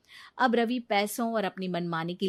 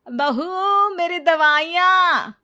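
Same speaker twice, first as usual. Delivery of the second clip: higher, louder, slow call; demanding mother-in-law; 9 semitones higher, 10 dB louder, much slower than usual. Acted. Mother-in-law calling her daughter-in-law for medicine, demanding. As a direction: drag out the demand for her pills.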